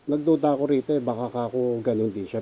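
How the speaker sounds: ordinary talk, nothing marked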